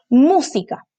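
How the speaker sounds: "música" with a drawn-out MÚ; stress on first syllable. Shown as a demonstration. Teacher reads out the exercise word, "música" stressed on MÚ. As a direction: stretch and punch MÚ in "música". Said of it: "Aquí está su sílaba tónica".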